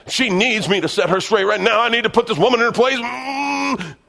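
high, loud, worked-up mock rant ending in a strained growl; screaming flesh. The flesh urging a husband to argue, high hammed-up outrage. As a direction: play an indignant husband, hammed-up and building. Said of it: "both spirit and flesh are screaming loudly".

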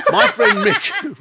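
high, loud, drawn-out Scouse; Liverpool accent. Acted. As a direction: exaggerate the Scouse, slowly and loudly. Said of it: "we were talking about that Liverpudlian accent".

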